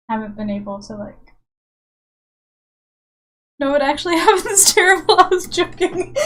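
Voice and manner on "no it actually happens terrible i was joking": high, loud, fast and giggly; higher, louder, laughing admission; laughing confession. Real, not acted. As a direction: blurt it out through laughter. Confessing the yawns are terrible.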